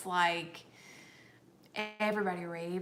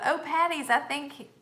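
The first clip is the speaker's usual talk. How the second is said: mild mock yell, higher pitch; retold yell; 6 semitones higher, 3 dB louder than usual. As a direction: read out someone's excited yell, lightly raised. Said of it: "Brian just yelled out".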